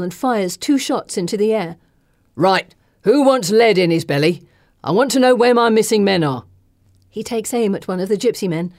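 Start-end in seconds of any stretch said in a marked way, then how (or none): 2.3-6.4 loud, rough threatening man; menacing thug. Acted. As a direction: bark it like a brute. Miller threatens with pistol.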